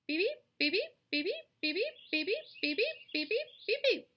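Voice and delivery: high, loud rising peeps; goslings peeping. Acted. The goslings answer the roll, high peeping. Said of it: "said the goslings".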